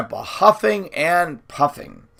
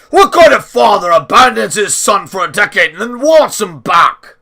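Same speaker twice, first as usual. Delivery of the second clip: loud, higher furious rebuke; thundering; 6 semitones higher, 8 dB louder than usual. A narrator's reading. Grandpa rebuking the little man, thundering. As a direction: thunder the accusation. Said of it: "Grandpa thundered".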